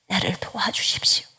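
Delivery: 훨씬 작게 숨소리로 속삭이는 간구; 마음을 찢듯 조용히 매달려. Shown as a demonstration. Prayer closes in whisper. (죄악에서 떠나길 구하며 속삭이듯)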